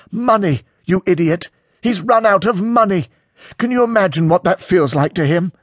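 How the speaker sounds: high, heated outburst; exasperated anger. A narrator's reading. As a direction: berate him with your pitch right up. Acted quarrel, close to blows.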